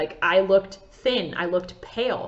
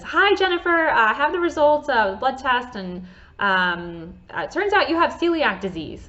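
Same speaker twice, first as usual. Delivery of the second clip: light higher mock doctor voice, breezy; a hint of a chipper doctor delivering news; doctor on the phone; 5 semitones higher than usual. Retelling the GI doctor's call to her, lightly voiced doctor.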